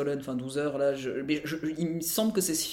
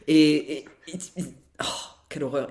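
mocking exasperated drone; scornful sigh; 2 semitones lower than usual. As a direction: scoff at the film, mock-dramatic. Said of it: "puis j'ai fait, oh, pff". (soupir méprisant)

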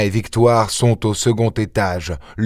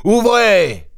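shouted command; 13 semitones higher than usual. Acted. Ganimard storms a bedroom door, shouting it open. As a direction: yell it at the door. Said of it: "hurla Ganimard".

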